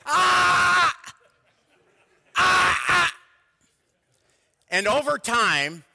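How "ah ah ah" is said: very high, loud alarm yell; panicked shout. Shown as a demonstration. Father yelling at kids as the ball rolls to the road, loud alarmed shout. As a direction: blurt a sharp alarmed "Ah!" at the child. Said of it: "So stop became this".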